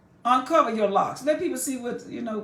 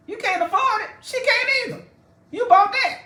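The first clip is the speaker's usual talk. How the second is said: high sassy put-down, louder at the end; mock scolding; 12 semitones higher, 4 dB louder than usual. Mocking rebuke, much higher.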